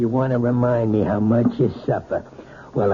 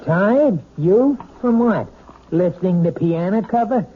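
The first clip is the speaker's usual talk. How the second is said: contemptuous husband jeering at wife; scornful mockery; 7 semitones higher than usual. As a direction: ridicule her excuse. Husband mocking his wife.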